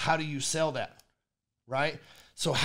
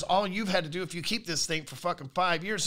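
ordinary talk, nothing marked

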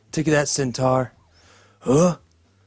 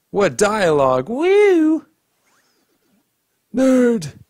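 ordinary talk

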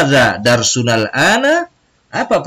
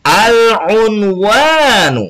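Al-Unwanu drawn out very slowly, higher and a bit louder; slow emphatic repeat; 8 semitones higher, 4 dB louder, much slower than usual. Shown as a demonstration. Teacher asking pupils to repeat once more, slow, raised model word. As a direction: call out Al-Unwanu again, slow and strong, for the class. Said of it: "Sekali lagi".

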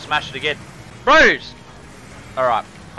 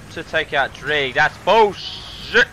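slow outrage; much slower than usual. Real, not acted. Complaining friend knocked out.

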